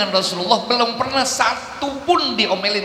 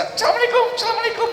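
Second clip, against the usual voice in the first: squawky, much higher parrot mimic; faster than usual. Preacher imitating a talking parrot, much higher and squawky. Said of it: "Burung beo baru bisa".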